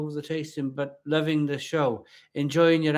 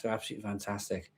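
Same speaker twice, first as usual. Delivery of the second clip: quick aside; 6 semitones lower, 13 dB quieter, much faster than usual. Real, not acted. Rushed quiet aside.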